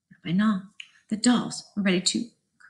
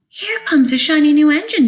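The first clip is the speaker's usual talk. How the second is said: excited call; 4 semitones higher, 8 dB louder than usual. Acted. Clown calling out excitedly.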